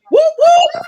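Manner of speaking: high loud laughing whoop; playful laughing shout. Retold or imitated. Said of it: "there'd be two of me telling".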